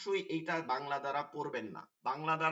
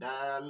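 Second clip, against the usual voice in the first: "দাল" with a long held aa; stretched vowel; 3 semitones lower, much slower than usual. Shown as a demonstration. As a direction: draw out the middle of "দাল". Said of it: "এক আলিফ করে টেনে টেনে". (এক আলিফ টেনে "দাল")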